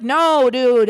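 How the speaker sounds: loud, exasperated mock protest; emphatic retold correction. Retells correcting her friend's mishearing.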